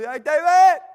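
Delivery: yelling for David across the field; calling out. Pretend. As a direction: shout his name from far away.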